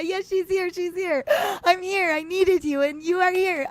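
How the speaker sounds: high, crying through the words; overcome, tears breaking into the voice; tearful joy. Real, not acted. Friend appears, overwhelmed with emotion.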